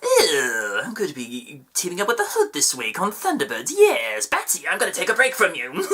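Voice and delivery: Joker impression with a sudden high squeal on yes; gleeful villain taunting Batman; mock Joker voice, playful and sly; Mark Hamill's Joker. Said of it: "Mark Hamill as a guest voice actor".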